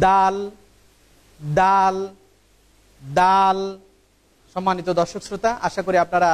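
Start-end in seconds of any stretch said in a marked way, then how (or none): 0.1-3.6 teacher drilling দাল; repeated letter demo. Shown as a demonstration. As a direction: model দাল slowly for learners. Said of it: "আপনারা আমার দিকে খেয়াল করুন".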